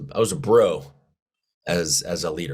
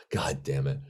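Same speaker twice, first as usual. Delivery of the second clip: muttered curse; 5 semitones lower, 8 dB quieter than usual. Retold or imitated. Sergeant walks out on him.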